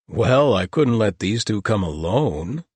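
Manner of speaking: lightly voiced amused paladin, slow; a touch of easy charm, unhurried; cheerful paladin. A narrator's reading. Paladin arriving to rescue friends, lightly amused.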